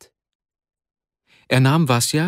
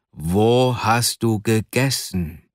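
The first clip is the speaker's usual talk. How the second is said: very slow, drawn-out question; insistent questioning; much slower than usual. A narrator's reading. Arkadi interrogating his pinned friend, slow insistent demand. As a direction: stretch out each word to press for an answer.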